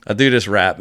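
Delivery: ordinary delivery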